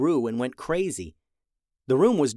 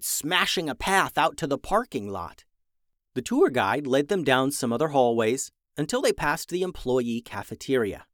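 usual speaking voice

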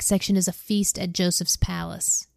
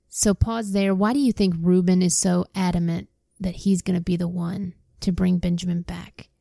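ordinary delivery

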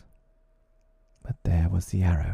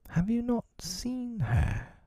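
slow, very high worried question; becoming troubled; slower than usual. A narrator's reading. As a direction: ask slowly, high, growing troubled. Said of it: "becoming troubled".

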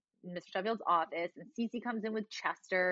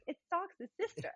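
imitating Sheffield; 11 semitones higher, 3 dB quieter than usual. Sheffield quoted.

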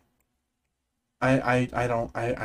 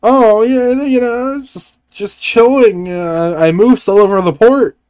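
hammy Ray Romano; 3 semitones higher than usual. Pretend. Improvised Ray Romano role-play.

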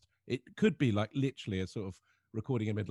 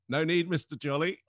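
higher, louder knowing dump-worker voice with a laugh; imitating the cardboard man; 8 semitones higher, 9 dB louder than usual. Dump worker greeting the teller, raised and laughing. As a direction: play the cheery dump worker who knows exactly who he is.

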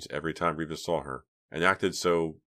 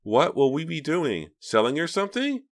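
put-on eager little girl; 10 semitones higher, 5 dB louder than usual. A narrator's reading. Lisa's girlish voice continues.

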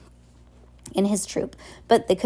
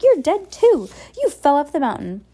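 much higher, loud astonished cry; shocked; 9 semitones higher, 6 dB louder than usual. A narrator's reading. Villainess seeing a presumed-dead child, loud shock. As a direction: gasp it out in disbelief.

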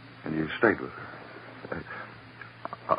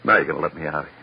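desperate demand; 5 dB louder than usual. Acted. Suspect demanding release.

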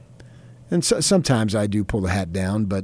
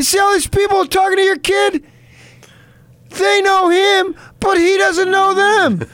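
high, loud, drawn-out shouting; yelling across shop; 6 dB louder, slower than usual. Retold or imitated. Mother shouting across the gift shop to her husband about their son, loud slow yelling. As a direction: call it across the store for everyone to hear.

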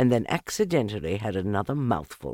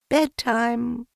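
higher motherly bedtime call; sing-song mother kangaroo; 4 dB louder than usual. A narrator's reading. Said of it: "she said".

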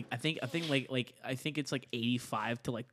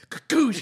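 high, loud imitated blast; shotgun noise; 10 semitones higher, 10 dB louder than usual. Player imitates shooting his friend on a mission, loud blast. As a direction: burst out a shotgun sound effect.

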